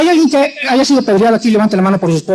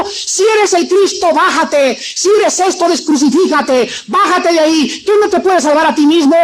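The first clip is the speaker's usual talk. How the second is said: high-pitched taunting shout at the cross; mob jeering; 6 semitones higher than usual. Retold or imitated. Crowd at the cross taunting Jesus, a mocking high yell. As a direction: jeer at him like an angry crowd. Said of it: "le estaban gritando".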